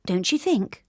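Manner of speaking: ordinary delivery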